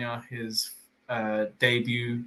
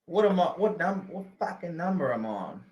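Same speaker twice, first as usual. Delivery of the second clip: higher, hammed-up Australian voice; mock Aussie accent; 6 semitones higher, faster than usual. Egged on by his friend, hammed-up Aussie accent. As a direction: put on a jokey Aussie accent. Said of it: "we gotta do the accent".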